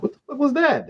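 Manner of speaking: high, shocked outburst; startled. Retold or imitated. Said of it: "I'm like".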